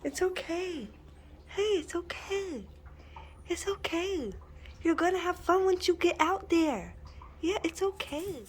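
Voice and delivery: calm her down softly; soothing. Real, not acted. Owner softly soothing her dog, who is scared of walks.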